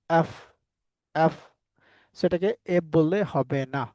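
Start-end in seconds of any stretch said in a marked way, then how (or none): 0.1-1.3 এফ with an open "a" vowel, "aef"; corrected English F. Shown as a demonstration. Teacher drills the class on F, corrected pronunciation. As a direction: model এফ slowly apart with a wide vowel. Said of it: "এটাকে কি বলতে হবে".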